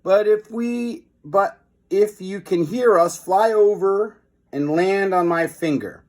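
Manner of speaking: high, slow, stretched call across the alley; calling out loud. Acted. Boy shouting up to the tiny hero, slow and high. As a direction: shout it out as loud as you can. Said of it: "He called out as loud as he could".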